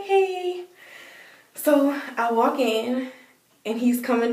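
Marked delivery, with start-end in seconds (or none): 0.0-0.6 high-pitched, awkward little hey; small high greeting. Retold or imitated. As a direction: retell a polite, sheepish hello. Timid greeting to boyfriend's dad.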